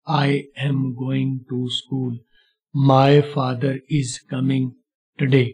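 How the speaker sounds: very slow, stopping between words; teacher mimics learners' halting English. Shown as a demonstration. Choppy, very slow imitation of learners. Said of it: "इस तरह रुक रुक के नहीं बोलेंगे".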